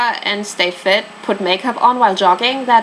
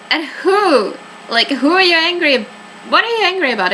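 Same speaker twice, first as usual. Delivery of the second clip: exasperated questioning; 8 semitones higher than usual. Real, not acted. Player exasperated with the suspect.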